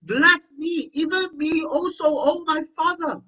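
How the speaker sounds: high, loud acted plea; anguished appeal, acted without sobbing; bitter cry. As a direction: cry out pleading for a blessing. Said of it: "exceedingly great and bitter cry".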